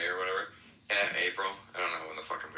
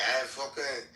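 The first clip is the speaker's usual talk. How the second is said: higher-pitched mock drunk British voice; 6 semitones higher, slower than usual. Pretending to be drunk abroad, mock British drunk. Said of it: "Just be drunk and be like".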